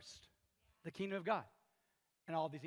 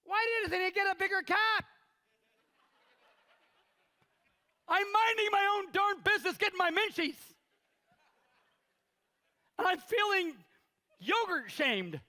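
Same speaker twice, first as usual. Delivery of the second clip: put-on high, loud child's voice; loud girl mocking the pastor; little girl; 12 semitones higher, 8 dB louder than usual. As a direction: play the girl mocking him. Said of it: "the little girl said".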